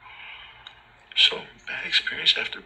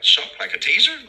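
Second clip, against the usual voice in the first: high, louder taunting question; mocking tease; 5 dB louder than usual. Teasing Officer Shaw about his name, high taunting tone. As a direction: needle him with it.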